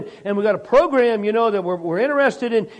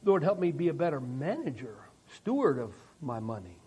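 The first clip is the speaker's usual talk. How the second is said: much softer, lower humble plea; humble prayer; 8 semitones lower, 10 dB quieter than usual. Acted. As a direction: pray it meekly, hushed and low.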